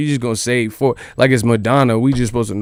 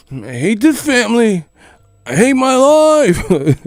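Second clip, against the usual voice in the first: put-on high, drawn-out whiny child voice; sulky kid; 9 semitones higher, slower than usual. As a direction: wail it like a kid denied a toy. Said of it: "you get mad 'cause you gotta put it back".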